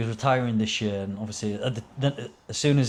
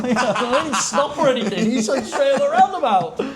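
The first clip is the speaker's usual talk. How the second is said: much higher and louder, words broken by laughter; laughing while talking; 12 semitones higher, 5 dB louder than usual. Real, not acted. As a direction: tell it while cracking up. Laughing while retelling crash.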